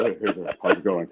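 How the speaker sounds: low, soft Batman-style greeting; a hint of Adam West's smooth hello. A lightly voiced Adam West greeting the young designer in the elevator, low and soft.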